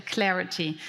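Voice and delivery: ordinary talk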